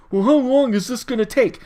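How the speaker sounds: put-upon, exaggerated griping question; mock complaining. Mimicking impatient complainers in a rant.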